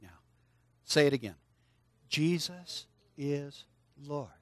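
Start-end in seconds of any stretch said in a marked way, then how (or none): 2.1-4.3 very slow, separated words to repeat; word-by-word prompt. Shown as a demonstration. Preacher has kids say it again, word by word. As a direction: space each word out for them to follow.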